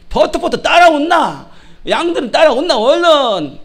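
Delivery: loud, high Gyeongsang dialect call. Shown as a demonstration. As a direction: call the sheep in a punchy Gyeongsang accent. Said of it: "경상도 말은 뭐예요?". (크고 높은 경상도 사투리로 부르듯)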